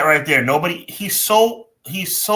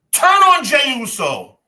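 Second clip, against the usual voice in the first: passionate shout; 9 semitones higher, 4 dB louder than usual. Real, not acted. Pleading for Cody to turn heel.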